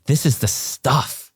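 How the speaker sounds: drawn-out this is the stuff; stretched-out delighted this is the stuff; excited. Retold or imitated. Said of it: "but I was like".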